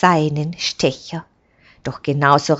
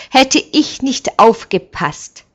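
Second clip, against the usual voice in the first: proud; 8 semitones higher, 6 dB louder than usual. A narrator's reading. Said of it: "sagt Heman stolz".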